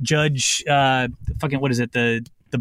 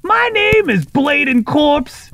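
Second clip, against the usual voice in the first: kenku mimicry; 11 semitones higher, 6 dB louder than usual. Kenku bird replaying the gnome's threat to the court, loud and high. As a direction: squawk it back like a recording of a shouting gnome. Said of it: "opens its mouth and says".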